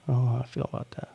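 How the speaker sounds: rushed, muttered under breath; fast throwaway mutter; fast mumbled repeat. Shown as a demonstration.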